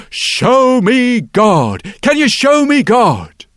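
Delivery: a stranger demanding loudly to see God; wild loud plea. Acted. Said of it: "And he says".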